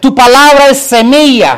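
high, loud preaching cry; preaching declamation. Real, not acted. Preacher building a list about God's word, loud declamation. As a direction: proclaim it at full force.

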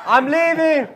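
high, loud breezy caller voice; imitating the latecomer phoning in, high and loud; mock latecomer. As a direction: toss it off like you're late again. Mimicking late caller.

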